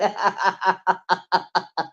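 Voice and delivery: warm burst of real laughter. Real, not acted. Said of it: "I'm laughing because".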